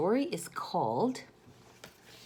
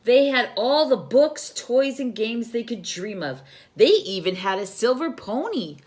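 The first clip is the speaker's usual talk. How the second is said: storytelling sister; 4 semitones higher, 8 dB louder than usual. Acted. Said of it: "continued Kendra".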